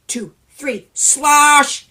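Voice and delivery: plain reading voice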